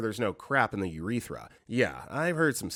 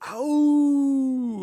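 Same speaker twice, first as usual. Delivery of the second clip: long, high, loud drawn-out "ow"; imitated group yell; 6 dB louder than usual. Everyone reacts to the search, hammed-up yell. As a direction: wail it out like a movie meme. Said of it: "Everyone yells".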